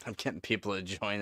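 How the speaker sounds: ordinary delivery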